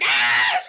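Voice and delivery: sudden loud shriek of fright; startled scream. Retold or imitated. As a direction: scream as if something grabbed your ankle. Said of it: "she's like".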